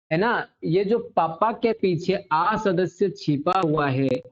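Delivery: ordinary talk, nothing marked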